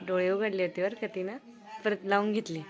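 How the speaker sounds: ordinary delivery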